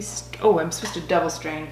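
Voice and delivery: usual speaking voice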